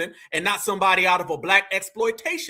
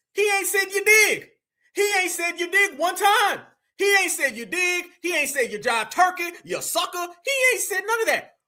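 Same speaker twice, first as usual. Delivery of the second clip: incredulous; 7 semitones higher than usual. Real, not acted. Mock outrage at missing catchphrases.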